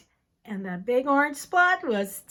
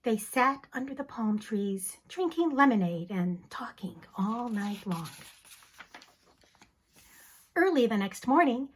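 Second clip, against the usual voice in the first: usual speaking voice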